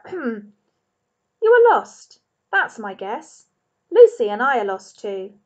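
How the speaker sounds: a lightly voiced bearded man, rising on lost; a hint of a kind wanderer; gentle man's voice. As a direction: talk kindly to the lost rabbit.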